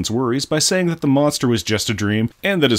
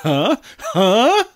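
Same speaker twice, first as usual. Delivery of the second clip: squeaky, louder prodding huh; pushy high huh; 12 semitones higher, 4 dB louder than usual. Real, not acted. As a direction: nudge the listener for a laugh. Fishing for a laugh at the pun.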